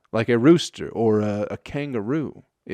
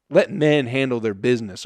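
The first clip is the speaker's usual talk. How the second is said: ordinary talk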